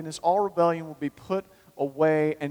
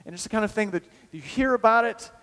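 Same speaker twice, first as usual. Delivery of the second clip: usual speaking voice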